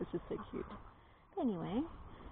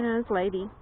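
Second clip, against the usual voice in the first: usual speaking voice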